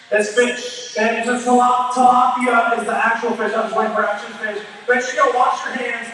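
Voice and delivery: normal talking voice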